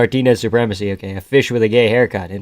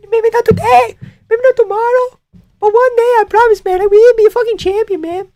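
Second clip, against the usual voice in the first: very high-pitched mock Mexican fighter; Moreno; 4 dB louder than usual. Streamer imitating a fighter vowing to be champion, very high squeaky voice. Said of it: "Now you're doing Marty".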